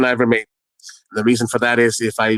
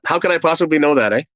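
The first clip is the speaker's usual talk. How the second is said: ordinary talk, nothing marked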